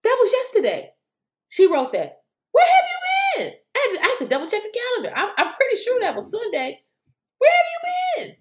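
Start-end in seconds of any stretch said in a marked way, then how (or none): 2.5-3.5 much higher, louder disbelieving yell; incredulous shout. Acted. Vlogger yelling at a late tweeter, incredulous shout. 7.4-8.4 high, loud, almost crying yell; anguished shout. Acted. Vlogger despairing at a clueless tweeter, anguished shout. Third repeat, near crying.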